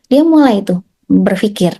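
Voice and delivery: usual speaking voice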